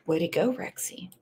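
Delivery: soft breathy whisper; whispered aside. Real, not acted. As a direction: drop to a whisper to cheer someone on. Aside cheering a chat member.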